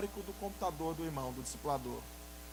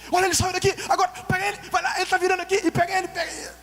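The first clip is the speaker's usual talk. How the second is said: much higher, loud excited directions; shouting stepfather; 11 semitones higher, 9 dB louder, faster than usual. Retold or imitated. Preacher imitates the gaúcho stepfather shouting directions at him. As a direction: bellow the way like a loud gaúcho dad. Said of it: "seu pai tava meio nervoso assim".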